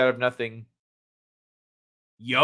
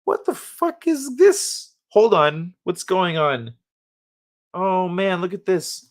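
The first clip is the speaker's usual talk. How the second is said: amazed discovery; 4 semitones higher, slower than usual. Retold or imitated. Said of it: "seeing the math in the world and going".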